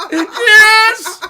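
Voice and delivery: high, loud tearful "yes"; hammed-up sobbing cheer; mock bawling. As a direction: cry it out in overwhelmed joy. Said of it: "bawling uncontrollably".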